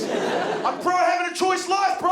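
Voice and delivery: mock shout. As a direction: yell it like a proud dude. Shouting dumb punchline.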